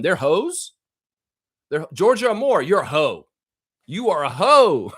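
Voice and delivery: hammed-up unapologetic player; mock player voice. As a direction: ham up a defiant player. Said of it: "It's like".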